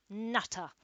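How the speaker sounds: gruff scornful grunt; grunted insult. A narrator's reading. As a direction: spit out the insult. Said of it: "carer grunt".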